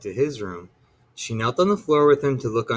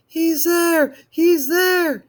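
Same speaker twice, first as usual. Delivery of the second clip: put-on high, loud panicked child; a scared boy running to his mother; frightened cry; 5 dB louder, much slower than usual. A narrator's reading. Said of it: "he cried".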